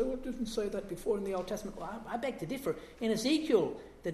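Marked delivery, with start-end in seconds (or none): 0.0-1.8 quick, dismissive objector voice; objector's retort. Retold or imitated. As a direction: rattle off the skeptic's objection. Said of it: "Some people object and say".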